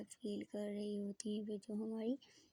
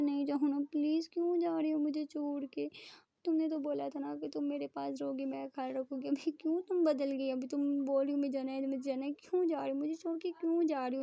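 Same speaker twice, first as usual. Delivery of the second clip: higher, begging please; pleading; 4 semitones higher, 5 dB louder than usual. Acted. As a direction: beg her not to leave. Preeta begs her to stay.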